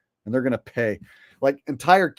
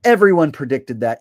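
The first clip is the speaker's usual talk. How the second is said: dismissive critics; 4 semitones higher, 7 dB louder than usual. Acted. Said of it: "people go".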